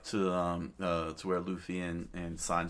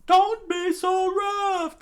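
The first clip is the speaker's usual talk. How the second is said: high, shrill scream of panic; 13 dB louder, much slower than usual. A narrator's reading. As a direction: scream it out in protest. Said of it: "the father screamed out".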